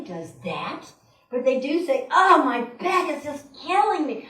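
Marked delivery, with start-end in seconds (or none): 2.1-4.3 higher, louder mock suffering; hammed-up complaint full of conviction; very emotional complaint. Speaker imitates people decreeing their aches, very emotional. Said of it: "with so much emotion".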